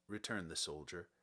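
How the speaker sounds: ordinary delivery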